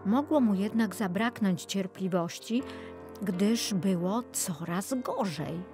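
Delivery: plain reading voice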